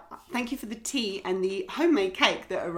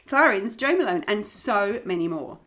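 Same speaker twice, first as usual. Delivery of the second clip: ordinary delivery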